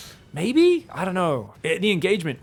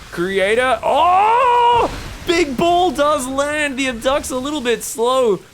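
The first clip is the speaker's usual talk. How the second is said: much higher, loud burst of hype; excited yell; 10 semitones higher, 8 dB louder, much slower than usual. Real, not acted. Caster to viewers as the big ball lands, yelling.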